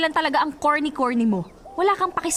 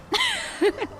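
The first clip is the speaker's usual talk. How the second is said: a high teasing giggle; mocking laugh; 3 dB quieter than usual. Friend laughing at his grandma excuse, teasing giggle.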